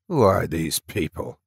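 lightly voiced old man, questioning hard; old lighter demanding. A narrator's reading. Senior lighter questioning the soldier, stern old voice. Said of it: "he demanded".

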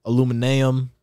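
"Aluminum" stretched to a-loo-muh-NAY-um; playful mispronunciation. Shown as a demonstration. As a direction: give "Aluminum" an extra NAY before the last syllable. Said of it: "It's kinda like aluminum".

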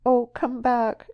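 high, loud, slow sobbing plea; sobbing cry. Acted. Woman calls her lover back through sobs. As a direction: cry out to him, sobbing like a child. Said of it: "she cried, sobbing and pulling".

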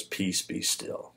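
soft, slow, nearly whispered command to the storm; calm warm command. Retold or imitated. Jesus calming the storm from the boat, soft and slow. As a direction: hush the storm gently, barely a breath. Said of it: "He just says it with a warm".

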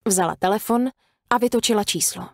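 usual speaking voice

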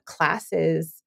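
ordinary talk, nothing marked

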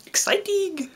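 very high, playful squeak; giddy falsetto; excited squeal. Pretend. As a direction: squeak it out high with mock giddiness. Squeals about the coming series.

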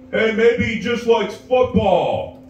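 nursing home resident poking the fight, lightly voiced. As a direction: drawl it out innocently.

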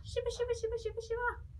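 high wobbling shivery voice; shivering voice. Shown as a demonstration. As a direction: shiver the words out. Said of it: "don't forget to shiver".

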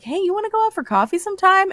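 high, bright flirty ask. Retold or imitated.

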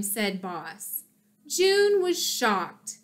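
ordinary delivery, nothing marked

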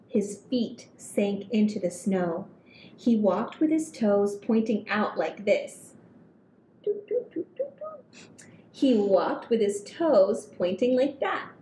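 put-on gentle read-aloud for kids; storybook reading. A narrator's reading. Reading picture book to children.

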